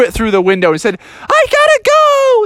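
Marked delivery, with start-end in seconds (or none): 1.2-2.4 loud, very high cub shriek; desperate yell. Acted. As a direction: scream it as you dive for the bathroom. Junior Bear desperate, breaking in.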